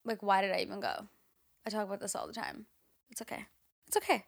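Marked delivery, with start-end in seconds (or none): 3.1-3.5 quiet self-reassurance. Real, not acted. Consoling herself about college.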